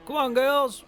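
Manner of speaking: loud herding call to the cows; hollering at the cows. Real, not acted. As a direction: call the cows over from afar. Calling cows across field.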